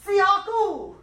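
high, projected African-voice call; put-on African voice for echoing. Shown as a demonstration. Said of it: "say it with your African voice".

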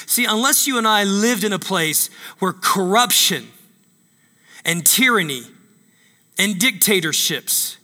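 ordinary delivery

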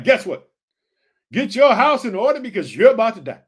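loud prophetic announcement; loud warning. Retold or imitated. Isaiah telling Hezekiah he will die, loud and urgent. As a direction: call the doom out loud.

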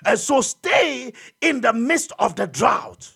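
loud, high preacher's cry; fiery preaching. Acted. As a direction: preach it out to the congregation. Preaching at full force.

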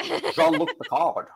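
put-on slow Sean Connery–style Picard accent. Asked about his podcast Picard, slow Sean Connery accent. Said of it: "Picard accent is pretty much just Sean Connery".

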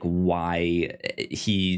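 ordinary talk, nothing marked

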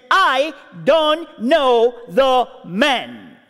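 slow, tight, drawn-out denial; tense denial. Retold or imitated. Peter denying Jesus a second time, slow and tense. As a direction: drag each word out under strain. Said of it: "This is no longer a casual conversation".

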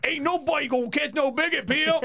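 hammed-up pimp character voice; Bigot Pimp voice. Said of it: "Who is that you're doing?".

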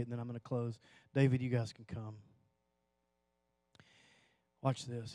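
ordinary talk

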